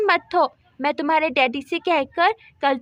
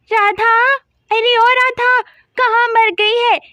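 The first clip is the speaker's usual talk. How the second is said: loud, higher angry call across the yard; calling out angrily; 4 semitones higher, 5 dB louder than usual. Acted. Neighbour shouting for Radha over the goats, angry call. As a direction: yell for her to come out now.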